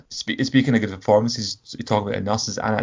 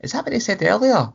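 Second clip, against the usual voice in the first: surprised; 13 semitones higher than usual. Retold or imitated. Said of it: "it was the second time I went".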